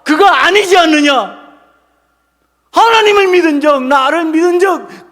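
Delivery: loud, high-pitched challenge; forceful rebuke. Acted. Jesus confronting the disciples, vehement rebuke. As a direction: press each charge home with full force.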